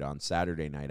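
ordinary delivery

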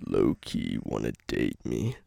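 low, slow deep rumble. Shown as a demonstration. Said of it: "say in that voice".

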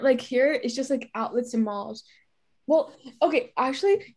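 ordinary delivery

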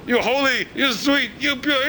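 lightly fawning praise; a touch of sugary compliments; hint of a flatterer's praise; mild mock flattery. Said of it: "Every place you go".

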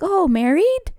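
high, rising retold question; acted-out nosy "oh?" up high; nosy surprise. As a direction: imitate a prying, surprised driver. Acting out nosy taxi driver's question.

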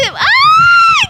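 high, loud "ay!" scream; startled shriek. Real, not acted. Guest interrupts herself at the gift, startled shriek. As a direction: let out a sudden high-pitched yelp.

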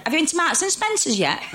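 high, bright, friendly local lady; a neighbourly stranger striking up chat; chatty Hull woman. Retold or imitated. Imitating a friendly Hull woman greeting her, high and bright.

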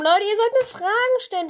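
high, loud nagging; whiny plea. Real, not acted. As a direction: whine at the viewers. Complaining pleadingly. (weinerlich fordernd)

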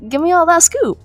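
ordinary talk

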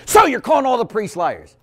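high-pitched mock outrage; retold accusation. Woman cornering the priest, high indignant accusation. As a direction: accuse him, voice lifted high.

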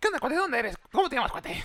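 high, loud joking voice; hammed-up joke voice; joke character voice. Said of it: "el chiste".

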